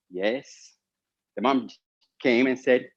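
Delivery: ordinary talk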